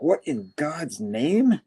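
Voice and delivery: drawn-out stunned question; slow, alarmed disbelief. Retold or imitated. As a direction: stretch it out, astonished. Norman alarmed by the intruder.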